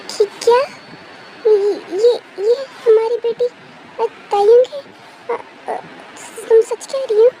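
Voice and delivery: stammering, astonished questions; stunned disbelief. Acted. Husband learns who the girl is, stunned and stammering. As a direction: falter and repeat words in shock.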